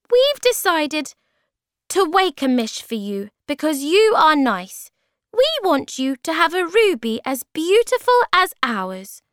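very high, loud little voice; high-pitched storybook creature announcing a gift; kindly tiny creatures. Acted. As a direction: pipe up eagerly, high above your own voice.

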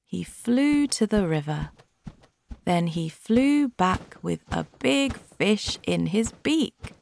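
plain reading voice

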